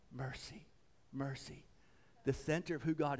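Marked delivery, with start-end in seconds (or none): 0.1-1.6 soft, low, lingering repetition; gentle awe, dropped in volume; hushed reverence. Real, not acted. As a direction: dwell quietly on each word. Dwells reverently on the word.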